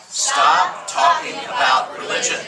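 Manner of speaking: shouted high and slow, leading the crowd; loud call-out. Shown as a demonstration. Preacher shouting for the crowd to repeat. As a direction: belt it out for the room to echo. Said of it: "Here we go".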